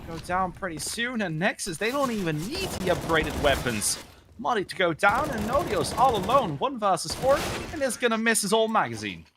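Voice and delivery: fast, rising esports commentary; excited play-by-play. Acted. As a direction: call the action with building hype. Live play-by-play of a round.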